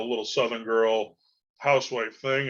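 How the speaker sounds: usual speaking voice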